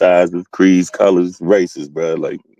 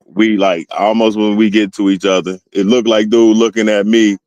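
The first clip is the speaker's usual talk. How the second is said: ordinary talk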